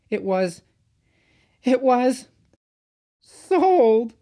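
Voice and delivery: high, loud, halting wail; mournful. A narrator's reading. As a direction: break the news in a high, mournful cry. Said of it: "finished Peter Sam mournfully".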